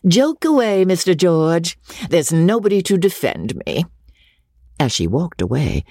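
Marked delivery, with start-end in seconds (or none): 0.1-3.8 high, sweet wounded innocence; innocent smile. A narrator's reading. Rebecca to George, feigning innocence, high sweet voice. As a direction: play the helpless victim sweetly. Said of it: "smiling as innocently as she could".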